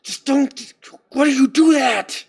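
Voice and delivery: mock angry outburst at himself; frustrated self-scolding. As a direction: snap at yourself. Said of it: "imagine me pacing".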